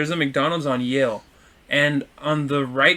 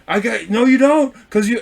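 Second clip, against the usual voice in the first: raised-pitch, stumbling objection; retold arguing; 7 semitones higher, 4 dB louder than usual. Truck driver arguing back, retold heatedly. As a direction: act out a driver contradicting.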